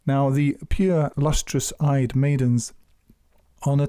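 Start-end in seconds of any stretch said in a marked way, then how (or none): none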